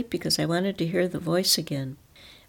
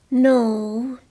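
solemn; 6 semitones higher, 6 dB louder than usual. A narrator's reading. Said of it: "he said then in a solemn manner".

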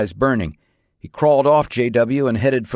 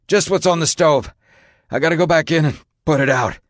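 raised, hurried raspy reply; strained, higher-pitched radio report; 6 semitones higher than usual. A narrator's reading. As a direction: rasp it out urgently over the radio. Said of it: "Grossman's voice came raspy over the radio".

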